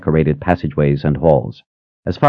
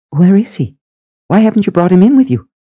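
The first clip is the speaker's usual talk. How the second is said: put-on high, quick, eager old sister; bubbly high-pitched sister asking; 14 semitones higher, 4 dB louder than usual. A narrator's reading. Phoebe voiced, eager question.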